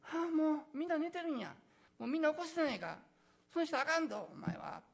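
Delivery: high, loud, fast Kansai scolding; sleepy friend telling him off in Osaka dialect; 大阪弁で叱る; annoyed Osaka dialect. Pretend. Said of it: "という感じですね".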